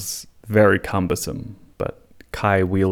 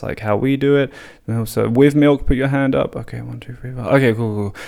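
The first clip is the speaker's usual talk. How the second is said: louder, higher, taking a show of hands; tea conductor; 6 semitones higher, 4 dB louder than usual. Retold or imitated. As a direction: count the votes like a teacher. Conducting police tea vote.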